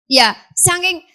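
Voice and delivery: ordinary talk, nothing marked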